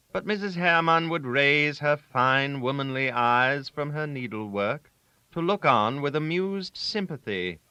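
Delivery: ordinary delivery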